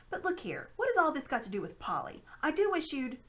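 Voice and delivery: a hint of an impatient boy; lightly voiced boy. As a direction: press the question urgently. Diggory protests.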